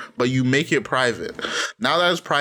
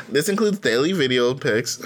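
usual speaking voice

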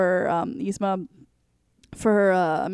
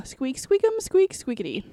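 rubbery high squeaky toy; 4 semitones higher than usual. Retold or imitated. Voicing the squirrel from the movie.

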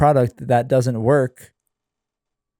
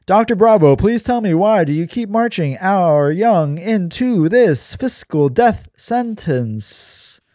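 pleading student; 6 semitones higher than usual. Acted. Chris voiced pleading in story.

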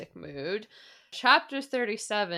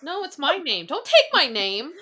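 mock indignant; 6 semitones higher, 5 dB louder than usual. Mock protest over shared name.

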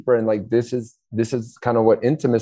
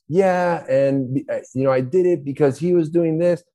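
put-on crying child; 7 semitones higher than usual. Child complaining to parents about brother, mock whining. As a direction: whine it like a hurt child tattling. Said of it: "child answering parent".

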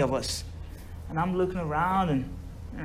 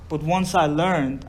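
ordinary talk

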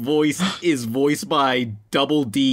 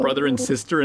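usual speaking voice

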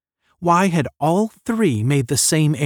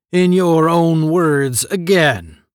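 gruff general; 5 semitones higher, 6 dB louder, slower than usual. A narrator's reading. General's gruff quoted order.

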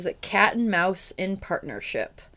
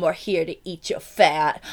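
hammed-up rap crew boast; mock rapper intro. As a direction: rap duo introducing themselves playfully. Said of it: "sounds like new rappers".

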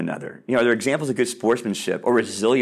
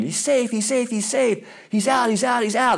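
mock team yelling; 10 semitones higher than usual. Two teams yelling back and forth.

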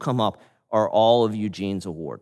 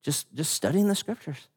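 ordinary talk

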